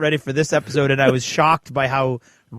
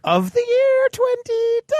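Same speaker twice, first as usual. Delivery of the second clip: high mocking voice. Running gag, repeated line.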